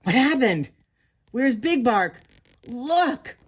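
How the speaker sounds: loud, startled calls. Acted. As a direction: cry out in bewildered alarm. Startled crowd after the swoop.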